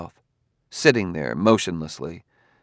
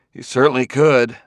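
higher and louder, with feeling; feelingly; 5 semitones higher, 5 dB louder than usual. A narrator's reading. Bailey answers Gladia about punishing his opponent, feelingly. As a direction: lean into the words feelingly. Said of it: "said Bailey feelingly".

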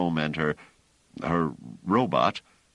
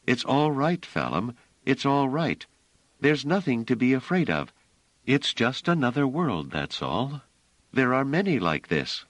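put-on higher, gentle voice of Bliss comforting a scared child; Bliss soothing; 8 semitones higher than usual. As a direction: hold her close and calm her, voice lifted.

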